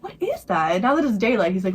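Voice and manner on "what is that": higher-pitched, baffled question; acted puzzlement. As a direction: imitate someone mystified by a noise. Man puzzled by the dripping.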